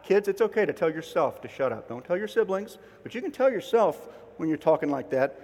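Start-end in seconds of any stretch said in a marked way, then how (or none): none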